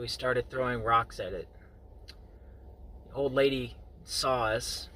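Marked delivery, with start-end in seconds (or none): none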